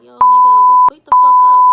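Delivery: high, loud furious scream; angry yell. Retold or imitated. Yelling at Sandy to wake up, high furious scream.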